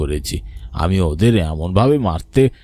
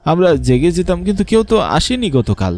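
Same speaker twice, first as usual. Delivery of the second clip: desperate pleading; 7 semitones higher than usual. Acted. Servant pleading innocence.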